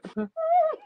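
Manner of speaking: high squeal of laughter; shrieking laugh. Real, not acted. Laughing at Robert's chat joke, high squeal.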